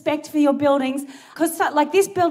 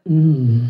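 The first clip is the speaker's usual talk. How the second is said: imitating a very low, long "mm"; deep drawn-out hum, mimicking a late-night host; low radio-host hum; 12 semitones lower, 3 dB louder than usual. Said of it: "interrupted by a guy going".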